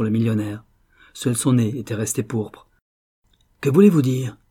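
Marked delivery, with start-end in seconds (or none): 3.6-4.4 loud, indignant demand; startled millionaire's acted testy question; muttered with an oath. A narrator's reading. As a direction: demand an answer angrily. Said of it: "murmura-t-il avec un juron".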